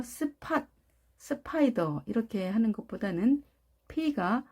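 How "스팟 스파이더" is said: 스팟, 스파이더 with a puffed Korean ㅍ; hard aspirated P. Shown as a demonstration. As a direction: separate "seu" then a breathy p in 스팟, 스파이더. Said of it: "P가 쌍비읍에 가깝게 발음하면".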